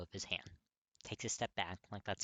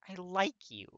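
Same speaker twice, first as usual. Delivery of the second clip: small heartbreaking voice; 9 semitones higher, 8 dB louder, slower than usual. Acted. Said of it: "in a small, heartbreaking voice".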